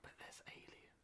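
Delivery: barely audible whisper; whispered aside. Real, not acted. Quiz player querying a wrong answer, whispered. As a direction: slip in a tiny whispered objection.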